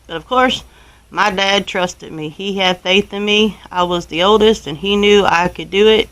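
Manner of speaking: ordinary talk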